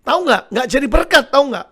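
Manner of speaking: acted-out scolding of a husband; mock angry complaint. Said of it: "ibadah Inggris marah dia".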